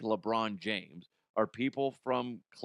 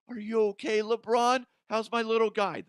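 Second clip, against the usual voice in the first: put-on higher, louder baby talk from the ref; ref cooing; 10 semitones higher, 5 dB louder than usual. Ref comforting LeBron, mock cooing baby talk. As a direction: coo at him like a toddler. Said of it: "This is the ref talking".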